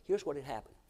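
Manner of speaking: ordinary talk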